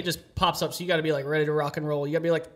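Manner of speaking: ordinary talk, nothing marked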